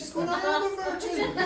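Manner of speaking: high-pitched mock protest; mock protesting Mary. Mary answering a doubting Joseph, high mock protest. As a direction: squeal it innocently in a high comic voice.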